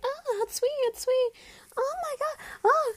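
tiny squealing animation voice, very high; high-pitched hentai voice. Retold or imitated. Mimicking women in adult animation, very high-pitched. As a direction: imitate exaggerated high-pitched cartoon moaning. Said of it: "making high-pitched noises".

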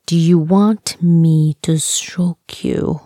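slow, measured re-asking; slow deliberate question. Acted. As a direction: spell the question out slowly, word by word. Repeats question after wrong answer.

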